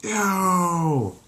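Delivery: high loud ew of disgust. Acted. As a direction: recoil in disgust. Crowd reacts to nipple lick.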